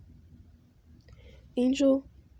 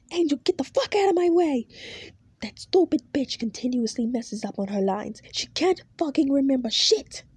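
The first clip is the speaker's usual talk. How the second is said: much higher, louder furious yell; furious shout; 4 semitones higher, 4 dB louder than usual. Acted. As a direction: bark him out of the way. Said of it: "he says as he continues".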